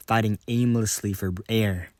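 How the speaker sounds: ordinary delivery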